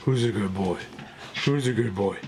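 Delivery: soft sing-song dog praise; praising a dog. Real, not acted. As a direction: coo at the puppy. Talking to a dog after video.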